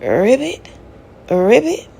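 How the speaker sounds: croaked ribbit, rising at the end; playful frog call. Retold or imitated. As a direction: mimic a little frog croaking. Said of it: "I hear again".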